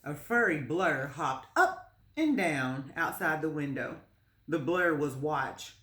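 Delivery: plain reading voice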